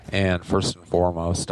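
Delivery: usual speaking voice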